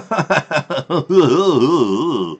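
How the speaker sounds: villain laugh. Pretend. Laugh after the mock Russian line.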